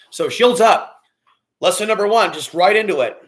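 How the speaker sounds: loud, high-pitched call to action; rally the group, loud and high. Real, not acted. Rallying viewers to start the lesson.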